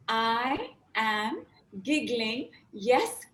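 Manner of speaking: "I am giggling" very slow, then yes, wow, now; slow demo. Shown as a demonstration. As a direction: stretch it out slowly. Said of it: "And now you're gonna say".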